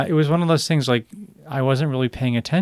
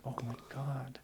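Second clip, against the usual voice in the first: "Oh, my God" almost breathed out, much softer; near-silent dismay; 21 dB quieter than usual. Retold or imitated. Hearing the punishment, near-silent dismay. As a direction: despair hardly voiced. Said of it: "And I'm like".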